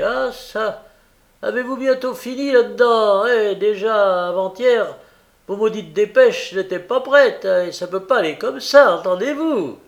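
loud, high-pitched complaint; blustering mail-coach driver; impatient courier scolding. A narrator's reading. Courier scolds the post office.

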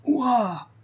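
high-pitched cheer falling away; triumphant cry. A narrator's reading. Tourist cheering the lasso catch, triumphant cry. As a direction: cry out a long hurrah. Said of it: "cria-t-il".

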